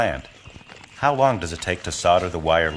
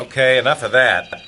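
raised, louder leader's voice; firm call to order; 6 semitones higher, 6 dB louder than usual. Acted. Troop leader to teasing boys, firmly calling order. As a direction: cut off the teasing, loud and higher.

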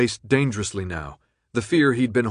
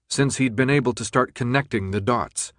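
ordinary delivery

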